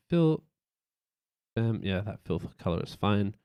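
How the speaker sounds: ordinary talk, nothing marked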